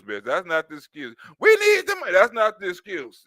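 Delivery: normal talking voice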